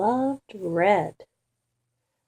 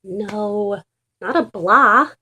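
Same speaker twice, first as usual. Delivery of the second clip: exasperated correcting; 6 dB louder than usual. Acted. Dog correcting the confused pigeon, loud and exasperated. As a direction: set the silly pigeon straight.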